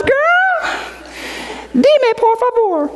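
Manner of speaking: put-on very high sassy Southern girl voice with Spanish; sassy Southern accent. Friend demanding details, high sassy Southern accent. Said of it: "I don't know if that's a Southern accent".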